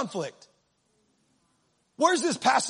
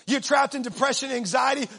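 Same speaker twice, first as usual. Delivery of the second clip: ordinary talk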